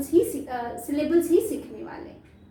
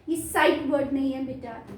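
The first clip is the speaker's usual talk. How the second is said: normal talking voice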